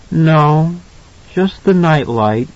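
put-on high child's voice, unhurried; small boy answering slowly; calmed little boy. A narrator's reading. Son settling down for mother, little boy voice.

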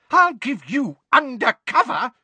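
high, loud, scolding retort; outraged cry. A narrator's reading. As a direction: throw it back at high pitch in outrage. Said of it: "cried Missus Figg".

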